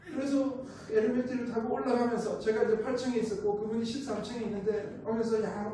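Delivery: normal talking voice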